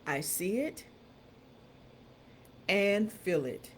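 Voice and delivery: slow, soft, measured affirmation with long pauses. Acted. Coach leading listeners through a quiet, solemn affirmation. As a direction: speak each phrase slowly, letting it sink in.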